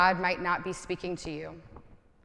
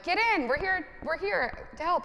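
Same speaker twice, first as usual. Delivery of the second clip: calling out; 9 semitones higher than usual. Retold or imitated. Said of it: "comes and is like".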